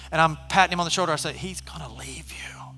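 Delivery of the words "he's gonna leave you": whispered aside; a quick hushed warning; urgent whisper. Retold or imitated. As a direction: hurry him along under your breath. Patting the addict's shoulder, urging.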